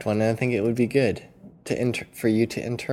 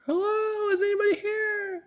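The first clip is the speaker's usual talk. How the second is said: high calling voice; calling from a mountaintop. Pretend. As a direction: shout out from a summit. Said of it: "You could do, like".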